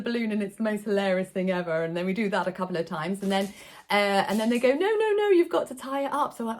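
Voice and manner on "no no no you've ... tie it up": put-on higher childish no-no-no; kids correcting. Entertainer imitating the children correcting her, lightly higher and eager.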